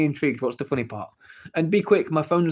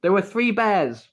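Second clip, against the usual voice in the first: slow, slightly higher bedtime story read; mock storytelling mother; 6 semitones higher, 4 dB louder, slower than usual. A mother teasing her kid with a bedtime story, slow and mock. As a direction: drag out the story line slowly. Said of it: "bedtime story".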